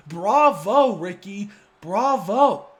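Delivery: loud mocking applause in words; sarcastic bravo. Real, not acted. [name] mocking Ricky's title win, loud sarcastic bravo. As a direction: congratulate him with a sneer. Mock congratulations to rival.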